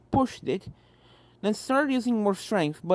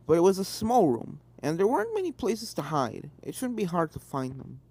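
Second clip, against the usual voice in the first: usual speaking voice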